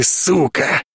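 put-on humiliated boyfriend hissing at Dan. A narrator's reading. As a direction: spit it out through your teeth. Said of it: "повторил то же самое для Марты".

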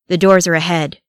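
ordinary delivery, nothing marked

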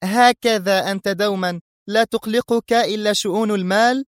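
put-on scornful young woman; disapproving. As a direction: reproach him with disdain. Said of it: "تقول في استنكار".

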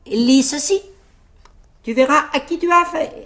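high-pitched cocky challenge; tailleur fanfaron. A narrator's reading. Tailleur défiant le géant. (défi lancé d'une voix perchée)

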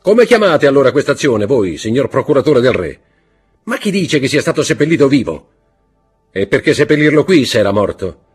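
forceful interrogating voice. Acted. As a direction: corner the prosecutor with questions. Count pressing the prosecutor.

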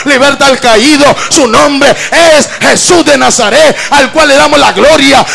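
loud, fervent shouted preaching, slowing on the big lines; preacher roaring praise to the church; full-force preaching. Acted. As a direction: rouse the congregation with full-throated praise.

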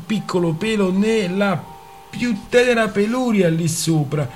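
a lightly voiced petulant uncle; petulant mockery. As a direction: tease the bald man with mock politeness. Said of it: "Con fare petulante continuò".

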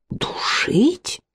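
breathy gasped whisper, pitched high; gasping in shock. A narrator's reading. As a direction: gasp the word out in horror. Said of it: "ахнула Элис". (испуганно ахнув, шёпотом)

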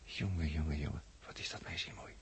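breathy, awed whisper under the breath; admiring whisper. Acted. Man watching the couple, whispering in awe. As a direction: hush it out as if she might hear.

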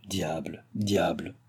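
muttered musing. A narrator's reading. Viscount musing, muttering to himself.

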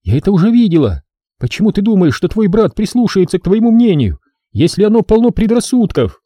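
forced out in disbelief; outraged girl. Acted. Girl outraged at the brother's insult. Drenna argues.

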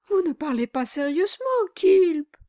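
high, hurried tearful plea; fast lightly tearful plea to husband; tearful pleading. A narrator's reading. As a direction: beg him in mild tears. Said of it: "dit la jeune femme en pleurant".

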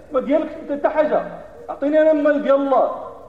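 loud, rising preacher's fervor; full-force preaching. Acted. Friday preacher exhorting worshippers on respecting the Prophet, fervent and loud. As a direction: preach it hard to the crowd.

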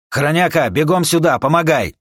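high, loud hurried call; urgent shout. Acted. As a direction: yell for him to hurry.

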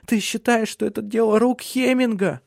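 high-pitched distressed woman's voice; anguished question to her husband; tearful. A narrator's reading. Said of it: "заплакала она". (высоким голосом, сквозь слёзы)

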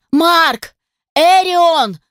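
high, loud calling shout; wailing like a siren. Acted. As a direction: yell the names like a siren. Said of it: "взвыла сиреной".

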